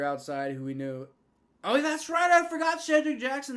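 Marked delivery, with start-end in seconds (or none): 1.6-3.6 much higher and louder, remembering with a jolt; light up as it comes back to you; sudden realization. Real, not acted. Fan suddenly remembering who Shedrick Jackson is, surprised.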